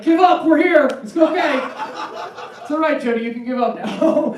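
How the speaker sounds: high, loud, laughing cat chorus; loud mock cats consoling a single woman; pack of cats. Cats rushing in on single Jody, loud mock comfort.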